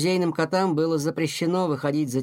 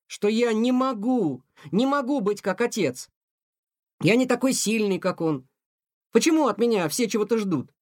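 higher, lightly voiced upset teenager; a hint of a boy pleading, hurt; teenage cat complaining to his mother, lightly voiced; young cat Tikhon; 7 semitones higher than usual.

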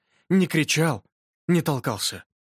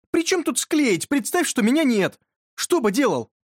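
exasperated insistence; 10 semitones higher than usual. A narrator's reading. Exasperated friend pressing his question.

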